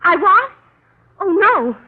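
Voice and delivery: jolted awake, caught off guard; a sudden startled question. Acted. Woken late, startled.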